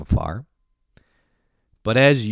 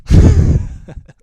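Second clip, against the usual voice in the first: loud, high real laugh; burst of laughter; 13 dB louder than usual. Real, not acted. Comedian after insulting Eastern European viewers, real laughing. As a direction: crack up at your own joke. Laughs at own rude apology.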